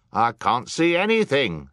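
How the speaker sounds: high, loud yell from the crowd. A narrator's reading. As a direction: yell it out in complaint. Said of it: "someone yelled".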